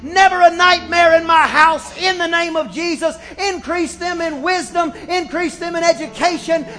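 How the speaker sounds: put-on loud, high preaching prayer over the children; fervent prayer. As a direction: pray it out with full conviction. Pastor prays over children.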